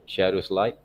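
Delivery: ordinary talk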